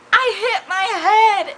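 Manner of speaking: high-pitched mock wail; mock bawling. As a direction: whine it like a bawling child. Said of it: "like, bawling".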